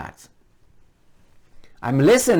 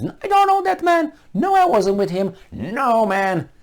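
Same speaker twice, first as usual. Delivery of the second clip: mock Peter denying; 12 semitones higher than usual. Peter denying Jesus three times, high and panicked. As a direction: deny it fast and frightened. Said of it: "He renounced, Jesus Christ three times".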